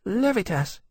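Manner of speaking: high, small dragon voice, shyly introducing himself; shy little dragon. A narrator's reading. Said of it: "he added shyly".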